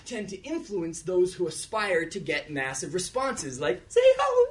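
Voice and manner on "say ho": mock hype-man yell; much higher, louder crowd call. Rapper hyping a crowd, high mock yell.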